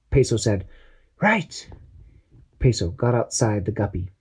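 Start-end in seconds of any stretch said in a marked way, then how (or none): 1.2-1.7 high squeaky sidekick voice; eager little crewman, pitched way up; Peso's high voice. Acted. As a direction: chirp it like an eager little helper. Peso answers.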